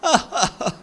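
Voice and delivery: loud high warm chuckle; hearty laugh. Acted. Fred laughing at Carlos, loud friendly laugh. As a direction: laugh kindly at the muddle. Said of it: "Fred laughs".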